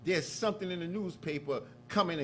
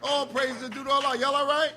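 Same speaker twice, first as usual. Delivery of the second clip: loud, driving sermon voice; preaching; 6 dB louder than usual. Acted. Minister preaching to the mosque about Farrakhan, loud and rousing. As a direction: build the congregation up, hammering each line.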